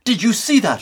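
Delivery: loud, high incredulous question; indignant astonishment; outraged disbelief. Acted. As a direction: demand a witness to the outrage. Just attacked, outraged.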